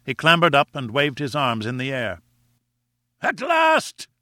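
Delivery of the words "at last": high, ringing shout of triumph; triumphant cry. A narrator's reading. Challenger crying out on reaching the far side, triumphant. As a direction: cry out in triumph at the top of the climb. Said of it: "he cried".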